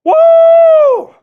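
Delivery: loud high whoop; hype whoop. Real, not acted. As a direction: whoop at the top of your lungs. Game day hype celebration.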